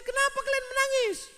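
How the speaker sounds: lightly voiced husband; high mock widower. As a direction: play the calm husband.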